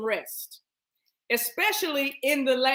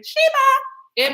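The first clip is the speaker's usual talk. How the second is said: high, loud cry; sudden piercing interjection; spirit exclamation; 13 semitones higher, 7 dB louder than usual. Real, not acted. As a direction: shout it like a jolt. Sudden spirit exclamation mid talk.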